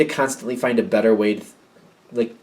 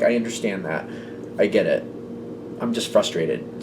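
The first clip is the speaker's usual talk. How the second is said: lower, softer, tired admission; quiet frustration; 4 semitones lower, 7 dB quieter than usual. Retold or imitated. Client admitting frustration to the helper, low and tired. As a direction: sigh it out, worn down. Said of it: "And you're like".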